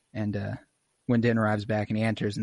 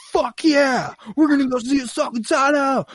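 much higher, louder fanboy yell; thrilled fan shout; 5 dB louder than usual. Retold or imitated. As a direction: cheer it like a hyped fan. Acting thrilled fans.

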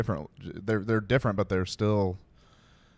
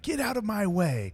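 much higher line reading; actor reading a line; 12 semitones higher than usual. Retold or imitated. Host quoting an actor trying a line in the car, hammed-up reading. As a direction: pitch it up like an overplayed actor. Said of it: "like an actor reading a line".

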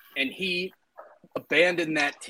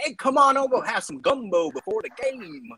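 put-on Cajun accent; 8 semitones higher than usual. Orgeron imitated inviting folks for gumbo, in a mock Cajun accent. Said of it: "That's my Cajun accent".

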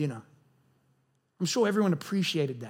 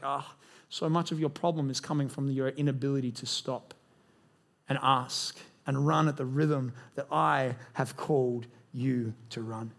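gentle divine voice; 5 semitones lower than usual. Pretend. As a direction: speak as the Spirit, low and unhurried. Holy Spirit speaks gently.

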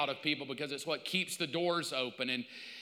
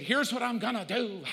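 bright, higher, eager announcement; excited prophet; 6 semitones higher, 3 dB louder than usual. Retold or imitated. Self-declared prophet to the crowd, excited. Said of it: "she said".

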